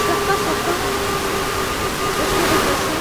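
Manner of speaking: urgent. Real, not acted. Instructing while hiding from monster.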